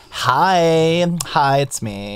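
drawn-out mock L.A. accent; L.A. caller. Imagining a phone call to him, mock L.A. accent. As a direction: stretch it like a caller from L.A. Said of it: "imagine calling him like".

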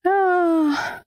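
long high "ah"; acted scream. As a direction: let out a high, restrained staged cry. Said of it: "ich muss immer schreien".

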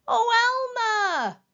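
loud, high plea; calling out. A narrator's reading. Stuck Wilbur calls after departing Elmer, pleading call. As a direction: cry out the name pleadingly. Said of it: "called Wilbur".

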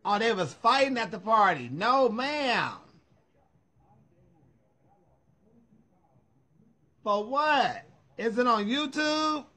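high, loud, incredulous questions; astonished reaction. Real, not acted. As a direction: react in disbelief to juicy gossip. Reacting to news of the fight.

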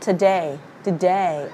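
weak first syllable in "today", falling; correct vowel demo. Shown as a demonstration. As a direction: model "today" as tuh-DAY. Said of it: "không nha, nó là âm".

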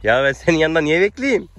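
high and loud protest; why should I wait, indignant; mock complaint. Real, not acted. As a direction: complain to the horse playfully. Arguing with the horse.

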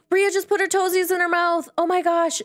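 high, excited exclamation; delighted surprise. Real, not acted. Player seeing Bria's toesies milestone, delighted surprise. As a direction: announce it in a lifted, thrilled pitch.

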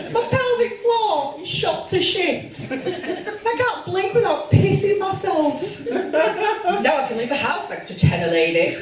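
high, mock-tearful wail of a woman at forty-five; crying ad woman. Comic plays a crying woman in an honest incontinence ad, high and wailing. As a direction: ham up a weepy confession for a bladder ad. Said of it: "red face, crying, going".

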